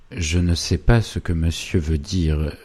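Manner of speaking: ordinary delivery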